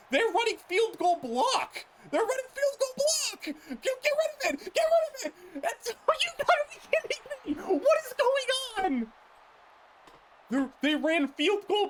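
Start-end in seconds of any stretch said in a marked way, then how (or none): 0.1-1.9 laughing disbelief. Real, not acted. Gamer reacts to opponent's play. 2.1-5.3 much higher, frantic, half-crying pleas; panicked yelling. Real, not acted. Gamer yells at screen in panic. 5.6-9.1 high, incredulous, laughing outcry; can't believe what just happened; stunned disbelief. Real, not acted. Gamer stunned by bad play.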